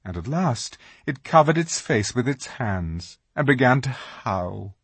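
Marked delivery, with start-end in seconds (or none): none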